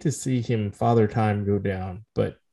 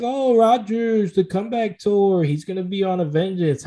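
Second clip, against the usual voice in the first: mock fan hype; 7 semitones higher than usual. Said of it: "Everyone was like".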